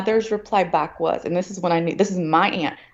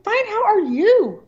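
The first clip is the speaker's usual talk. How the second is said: much higher, slow, exaggerated fake-nice reply; sarcastic mock politeness; 4 dB louder, slower than usual. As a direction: drag it out in sugary sarcasm. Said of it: "And I was like".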